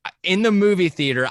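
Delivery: ordinary talk, nothing marked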